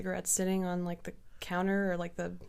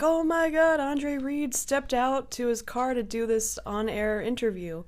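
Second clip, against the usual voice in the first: mock a thrilled sports fan; play-acted awe, pitched up; imitated excitement; 6 semitones higher, slower than usual. Bar crowd thrilled about football star.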